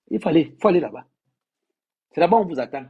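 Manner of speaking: high, loud and fast urging; urgent exhortation. Real, not acted. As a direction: push them out the door. Urging bloggers to go there.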